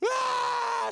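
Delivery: high, loud drawn-out scream; mock scream. Woman in labor interrupts phone call, hammed-up scream. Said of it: "She starts screaming".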